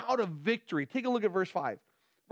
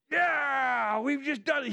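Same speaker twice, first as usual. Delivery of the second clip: triumphant cheer; 6 semitones higher, slower than usual. Acted. Voicing victorious celebration.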